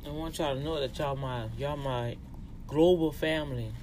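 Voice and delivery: usual speaking voice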